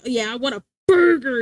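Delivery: BURGER punched loud with a hard American R; mock American burger order, R's fully sounded. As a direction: lean heavily on both R sounds in burger. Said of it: "like, a hard burger".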